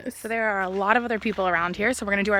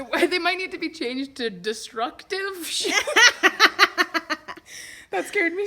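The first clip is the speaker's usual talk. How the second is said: joking through laughter; laughing; 7 semitones higher, 4 dB louder than usual. Real, not acted. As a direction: giggle while teasing. Joking after the fall.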